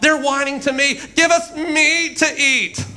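high, hammed-up whining; mock-whiny wail; Moses. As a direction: mimic the whiners in exasperation. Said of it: "like he's acting it out".

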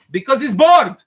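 high and loud comic outburst; exasperated punchline. Acted. Rebbe to viewers, a loud mock-exasperated quip about Hashem. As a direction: toss off the joke with hammed-up exasperation.

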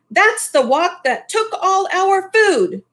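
high, upset cry of a wife; mild voiced upset mother. A narrator's reading. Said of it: "cried Missus Lee".

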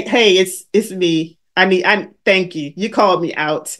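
imitating Cynthia. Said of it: "She say".

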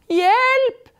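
high, loud alarmed yell; cry for help. A narrator's reading. Thief is pushed over.

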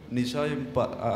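normal talking voice

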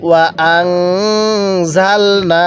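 very slow chant with the ghunnah held long; slow nasal-held recitation. Shown as a demonstration. Teacher models the ghunnah for students, slow chant. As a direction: hum the nun through the nose, drawn out. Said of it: "গুনাহ করব".

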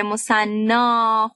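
مثنا stretched very slowly, final آ held long; drawn-out pronunciation demo. Shown as a demonstration. Teacher to students, carefully sounding out مثنا.

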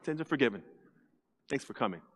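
low, soft, offhand Jesus; calm quiet Jesus. Retold or imitated. Jesus quietly brushing off the crowd, soft and low. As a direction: say it gently, almost dismissive. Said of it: "and says".

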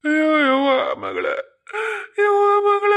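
high, loud wail breaking into sobs; acted wailing. Mother crying for her dead daughter, wailing. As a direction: cry out for the child, stretching the last word.

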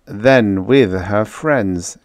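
slow, expansive delivery. Acted. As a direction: spread it out grandly. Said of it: "he said expansively".

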